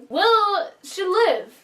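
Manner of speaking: slow, choked-up plea about the ending; tearful plea. Real, not acted. Viewer pleading that the character survive, slow and tearful. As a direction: let the voice catch as you beg for him to live. Upset over the story's ending.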